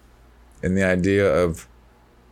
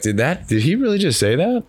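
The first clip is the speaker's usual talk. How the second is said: higher-pitched, lightly acted incredulous question; mock disbelief; 8 semitones higher than usual. Voicing a listener shocked by the remark, mildly incredulous. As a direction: react like a taken-aback listener.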